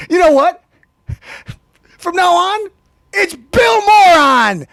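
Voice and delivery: high, loud, slow nickname yell; mocking shout. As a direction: stretch out Moron as a loud jeer.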